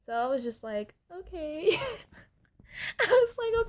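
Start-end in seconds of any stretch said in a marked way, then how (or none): 1.1-3.7 laughing along. Retold or imitated. Caving in to boyfriend's request.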